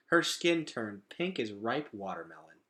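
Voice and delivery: ordinary delivery, nothing marked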